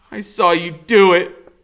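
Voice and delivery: put-on a boy facing the killer, still shouting; accusing shout. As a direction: say it straight to his face, defiant. Boy confronts killer after question.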